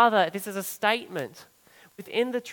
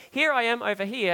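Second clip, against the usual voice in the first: higher and louder, like waving a hand to be noticed; eager hand-raising call; 5 semitones higher, 5 dB louder than usual. Retold or imitated. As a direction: call out your location keenly, mock-eager. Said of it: "raising his hand going".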